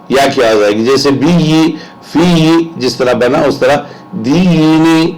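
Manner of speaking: ordinary talk, nothing marked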